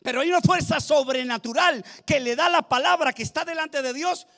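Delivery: high, driving sermon voice; fervent preaching. Real, not acted. Preacher announcing a supernatural force in the word, fervent.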